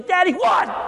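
put-on high-pitched kid's cry; startled child. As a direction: call out like a bewildered little kid. Voices a startled kid yelled at.

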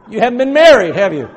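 loud playful dig at the married folks; teasing jab. Real, not acted. Preacher teasing the congregation about marriage, loud and playful.

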